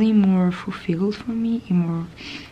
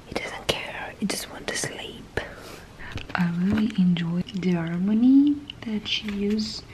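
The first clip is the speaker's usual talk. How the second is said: hushed unboxing whisper; 3 semitones higher than usual. Real, not acted. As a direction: breathe the words close to the mic.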